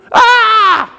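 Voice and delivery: high, sustained "aaah"; mock frightened scream. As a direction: shriek as if hit by a wave. Acting Peter's fright.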